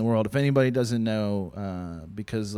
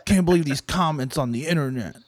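higher whiny mock complaint; whiny mock rapper; 4 semitones higher than usual. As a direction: whine it like a sulky rapper.

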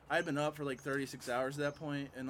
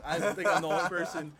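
usual speaking voice

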